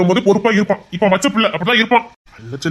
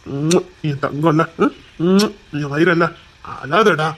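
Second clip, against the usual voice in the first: low, gentle cajoling; tender coaxing; 4 semitones lower, slower than usual. Acted. Elder calming a crying dear one, tender coaxing. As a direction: coax gently in a lowered voice.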